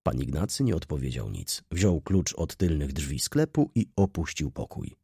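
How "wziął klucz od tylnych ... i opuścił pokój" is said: usual speaking voice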